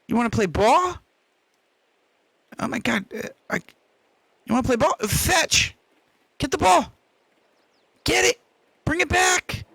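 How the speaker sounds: high excited fetch calls; squeaky praising a dog. Real, not acted. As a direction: hype the dog up, high and bright. Playing fetch with his dog.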